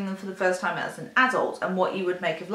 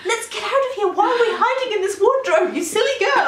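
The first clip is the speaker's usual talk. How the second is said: high, louder, laughing worried scold; fretful Pan; 13 semitones higher, 6 dB louder than usual. Pretend. Podcaster playing the cautious daemon scolding Lyra, laughing fret. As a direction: fuss like an anxious companion. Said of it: "I am like Pan".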